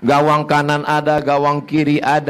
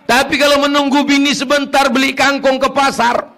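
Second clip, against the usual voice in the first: preaching at full force; 11 semitones higher than usual. Acted. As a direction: lay into the husbands from the pulpit. Preacher mocking husbands.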